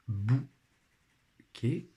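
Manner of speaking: "Bouquet" split into bou, long pause, quet; syllable by syllable. Shown as a demonstration. Teacher helping pupils find the syllable, syllable by syllable. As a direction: sound out "Bouquet" slowly in two beats. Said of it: "dans leur première syllabe".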